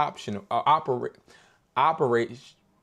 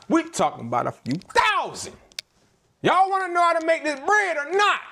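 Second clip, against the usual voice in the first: much higher, louder hype-man voice; hyped-up pitch; 4 dB louder than usual. Real, not acted. Pitching his viewers on real money, hyped and loud. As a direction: sell it like a hype man at full energy.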